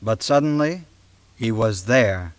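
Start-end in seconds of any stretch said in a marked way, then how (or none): none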